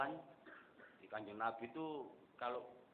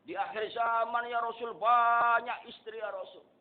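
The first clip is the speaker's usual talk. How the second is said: loud, raised sermon cry; fervent preaching; 10 semitones higher, 10 dB louder than usual. Acted. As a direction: proclaim each line to the crowd. Preaching at full force. (khotbah lantang berapi-api)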